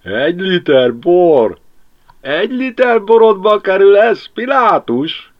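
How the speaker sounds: Jóska mocking Pilátus from bed, laughing loudly. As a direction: crow with glee. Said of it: "nevetett még az ágyban is Jóska".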